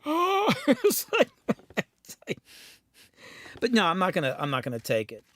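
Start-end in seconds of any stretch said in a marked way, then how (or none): 0.1-0.5 a high, loud gasped Oh of shock; the stunned singer reacts to the blow-up: high startled Oh; shocked little oh. Retold or imitated. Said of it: "she's there just like". 0.6-2.4 breaking into laughter mid-phrase; recalling the scene, he cracks up laughing. Real, not acted. Laughing at the memory.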